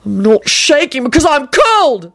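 high, drawn-out furious protest; acted enraged outburst; wild shout. A narrator's reading. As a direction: shout it wildly, pitch climbing. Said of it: "Neville shouted wildly".

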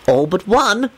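mildly sulky reply; understated petulance. A narrator's reading. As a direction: answer with a touch of a sulk. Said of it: "Lupin rejoined sulkily".

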